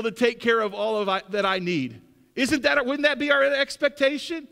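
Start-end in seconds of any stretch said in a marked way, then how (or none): none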